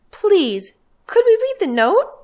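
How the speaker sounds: put-on high child voice trying to sound confident; a young girl putting on a grown-up, sure tone; self-assured girl. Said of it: "trying to use the self-assured voice".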